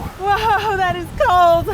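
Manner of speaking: shouting over wind. Real, not acted. Cold wind on the summit.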